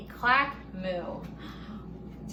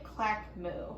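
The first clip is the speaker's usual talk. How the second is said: ordinary delivery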